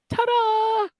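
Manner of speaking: high, drawn-out ta-da; showy reveal. Acted. Host unveiling the penis plant, showy ta-da. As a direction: sing it out like a magician's reveal.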